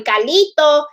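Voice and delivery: Carlito with a Spanish trill and open a; Spanish accent. Shown as a demonstration. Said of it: "say it with a Spanish accent".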